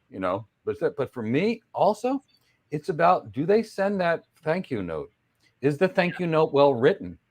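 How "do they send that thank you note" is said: ordinary delivery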